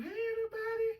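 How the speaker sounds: very high, loud bubbly call to the room; cheerful greeting. Retold or imitated. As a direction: burst in greeting everyone. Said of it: "she came through".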